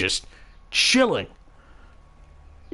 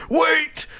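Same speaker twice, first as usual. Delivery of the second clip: dramatic courtroom shout; 14 semitones higher, slower than usual. Acted. Friend bursting into court to stop the verdict, shouting high. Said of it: "Blaze will burst in the doors".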